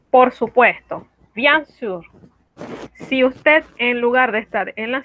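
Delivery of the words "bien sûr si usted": ordinary talk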